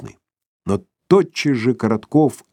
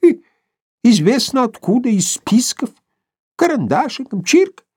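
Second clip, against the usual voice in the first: put-on old prospector. Old man voiced in story.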